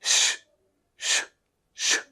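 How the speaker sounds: श with the tongue curled up toward the palate; श as a soft shushing hiss; sh sound. Shown as a demonstration. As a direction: blow air out for a soft श. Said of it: "बाहर की तरफ हवा निकालें".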